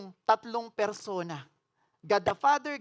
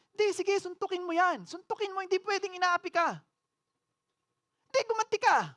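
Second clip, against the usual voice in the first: higher, coaxing voice of the tempter; tempting Satan; 10 semitones higher than usual. Acted. Preacher voicing Satan urging a listener to fight, higher and coaxing. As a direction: egg them on like a devil whispering.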